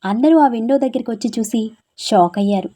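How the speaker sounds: usual speaking voice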